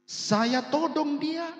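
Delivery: high, loud, drawn-out exclamation; acted-out astonished outburst; retold amazement. Retold man tells villagers, amazed.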